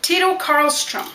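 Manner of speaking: ordinary talk, nothing marked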